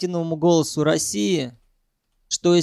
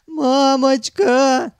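long, high wailing cry; tearful scream; 8 semitones higher, 5 dB louder, much slower than usual. A narrator's reading. Sanyok crying for his mother, screaming. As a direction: cry out desperately for help, stretched. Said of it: "закричал он".

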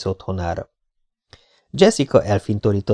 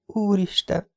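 horrified to herself; 10 semitones higher, 5 dB quieter, slower than usual. A narrator's reading. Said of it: "szörnyűködött magában Jessica".

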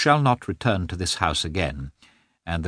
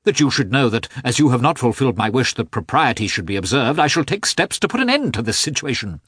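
louder, higher cold anger; angry; 6 semitones higher, 4 dB louder than usual. A narrator's reading. Husband threatening his wife, angrily. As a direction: lay down the threat with force. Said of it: "just as angrily".

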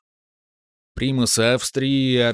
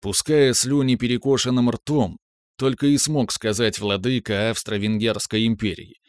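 ordinary delivery, nothing marked

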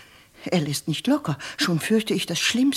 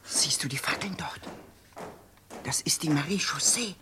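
hushed, breathless whisper; a servant pointing out the torches in the dark; frightened whisper; 4 semitones lower, 10 dB quieter than usual. Acted. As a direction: whisper so the patrol can't hear. Hiding from the night patrol.

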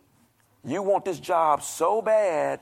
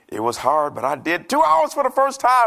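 high, loud burst of excitement; excited; 8 semitones higher, 5 dB louder than usual. Retold or imitated. Congregant texting the pastor about praying two hours, excited. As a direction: rise into a proud, excited shout. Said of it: "they were excited".

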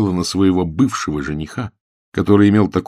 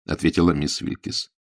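ordinary delivery, nothing marked

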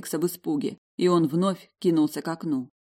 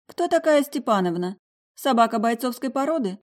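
raised, curious question; surprised question; 7 semitones higher, 4 dB louder than usual. A narrator's reading. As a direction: ask in surprise, a bit higher. Karina asks, surprised.

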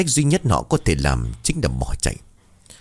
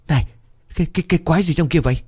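high, fast stammer of alarm; startled cry; 8 semitones higher, much faster than usual. A narrator's reading. Man suddenly spots something in the bushes, startled high stammer. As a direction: cry out, tripping over the first words. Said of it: "dừng lại rồi la lên".

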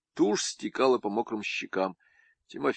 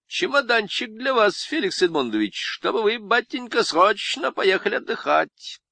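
much higher, sly Lenin voice; high wheedling Lenin impression; 12 semitones higher, 4 dB louder than usual. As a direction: coax like a crafty Lenin. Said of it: "по-ленински".

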